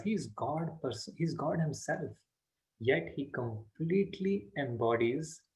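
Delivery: usual speaking voice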